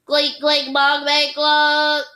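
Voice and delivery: high, loud clunky notes; piano noises. Pretend. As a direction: bang out the noise. Humphrey bangs keys again.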